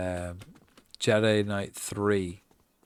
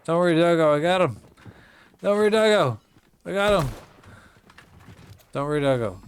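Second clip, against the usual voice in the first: loud, higher calls to teammate; rising then easing shouts; excited shouting; 6 semitones higher, 7 dB louder, faster than usual. Real, not acted.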